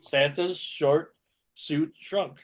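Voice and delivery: slow, separated words; slow demo. Shown as a demonstration. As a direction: show it slowly.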